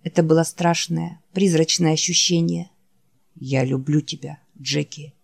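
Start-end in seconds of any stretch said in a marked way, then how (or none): 3.4-5.1 low, soft, worn-out creature voice; barely has the strength to say the name; weary dying Wolf. Acted. Wounded Wolf calling his friend Jack, low and faint. Wolf's voice.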